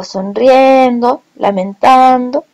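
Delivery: slow "sonriendo, lamentando", stretching "rien" and "tan"; stressed vowels. Shown as a demonstration. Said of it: "la última vocal acentuada no es la misma".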